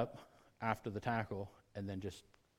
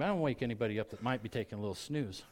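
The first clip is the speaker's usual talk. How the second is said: ordinary talk, nothing marked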